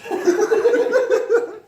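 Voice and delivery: high loud real laugh; loud laughing burst. Real, not acted. Reader laughing at the Satanist line.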